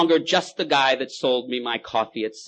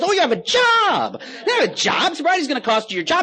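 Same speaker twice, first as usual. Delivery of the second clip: laughing God; 7 dB louder than usual. Acted. God laughing at the drunk, much higher. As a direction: chuckle at the drunk's claim. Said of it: "God goes".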